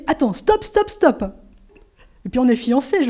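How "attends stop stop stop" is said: a retold panicked stop, much higher; urgent shout of stop. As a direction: yell at her to halt mid-run. Fiancé suddenly stops her running.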